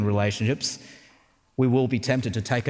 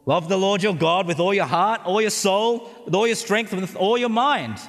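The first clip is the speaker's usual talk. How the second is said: smug recital; 8 semitones higher than usual. Retold or imitated. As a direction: rattle it off like a show-off star pupil. Know-it-all teacher reciting the law.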